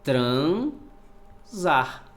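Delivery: "Transar" split as tran... zar; syllable by syllable. Shown as a demonstration. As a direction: stretch "tran", pause, then "zar". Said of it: "vamos separar as sílabas".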